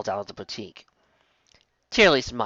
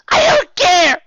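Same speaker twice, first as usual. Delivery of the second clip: high, loud tearful cry; 11 dB louder, much slower than usual. A narrator's reading. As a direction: cry out upset. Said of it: "cried Sweet Belle".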